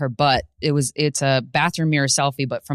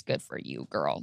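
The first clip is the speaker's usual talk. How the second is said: put-on whispered good for you girl; 13 semitones lower, 12 dB quieter than usual. Comedian cheering [name] on: soft whisper. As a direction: breathe it as a secret aside.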